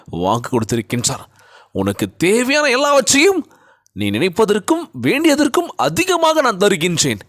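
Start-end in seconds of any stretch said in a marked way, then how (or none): 1.7-7.2 high, emphatic declamation; ringing preacher's promise; God's promise. Acted. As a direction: declare it as God, voice lifted.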